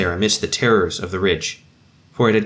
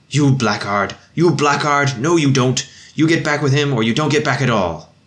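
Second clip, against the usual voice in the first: loud, fierce inner scolding, higher; fierce self-rebuke; 5 semitones higher, 4 dB louder than usual. Acted. Man berating himself to keep carrying the captain, fierce loud scolding. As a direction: drive himself on with a harsh shout.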